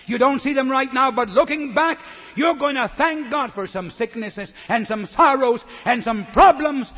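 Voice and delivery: loud, high preaching build; full-force preaching. Acted. Preacher exhorting the congregation at full volume. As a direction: drive it home to the crowd.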